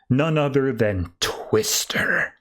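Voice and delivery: slow, drawn-out punchline; mock-ominous announcement, very slow; dramatic reveal. A narrator's reading. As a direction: drag out the last word for suspense.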